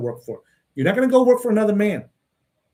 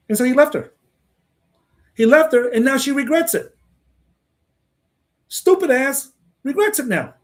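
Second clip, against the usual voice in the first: higher, louder, scornfully worked up; heated scorn; 7 semitones higher, 3 dB louder than usual. Real, not acted. Speaker mocking the girl who left, heated scorn.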